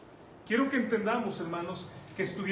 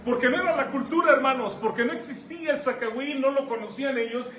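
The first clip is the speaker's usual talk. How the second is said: preaching at full force, louder and higher; forceful preaching; 5 semitones higher, 5 dB louder than usual. Acted. Preacher raises his voice to the brothers, forceful preaching. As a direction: drive the point home to the congregation.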